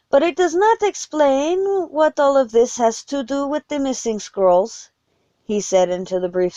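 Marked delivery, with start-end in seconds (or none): 0.1-4.9 higher-pitched questioning male character; lightly voiced Alistair. A narrator's reading. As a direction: lightly shift into a higher character voice, pressing for an answer.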